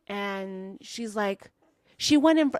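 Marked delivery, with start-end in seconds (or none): 2.0-2.6 mock outburst, pitched high and loud; high, loud retold complaint, cut off abruptly; triggered. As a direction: imitate someone suddenly incensed. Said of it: "like, she got triggered".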